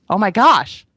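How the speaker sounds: loud, high burst of wonder; a loud gasped oh my gosh; awed exclamation. Real, not acted. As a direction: exclaim it in sheer amazement. Exclaiming awe at revelation.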